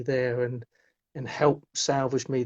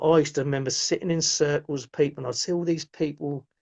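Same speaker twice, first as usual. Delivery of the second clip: usual speaking voice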